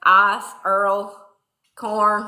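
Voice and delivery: "Ice" said the Southern way, almost "ahs", and "Earl" drawn out as "ur-ul"; southern pronunciation. Shown as a demonstration. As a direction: say "Ice, Earl, corn" in a Louisiana drawl. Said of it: "Sometimes when I say ice".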